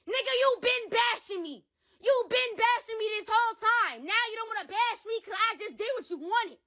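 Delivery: high, heated accusation; angry outburst. Real, not acted. Father to the rapper who dissed him, heated and high. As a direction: throw it back at him hard.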